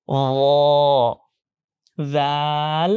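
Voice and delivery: heavy rounded "র", then long open "জাল"; thick-thin contrast. Shown as a demonstration. Teacher sets the two letters apart, thick-thin contrast. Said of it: "র উচ্চারণ করার সময় আ সাউন্ডটা থাকবে না".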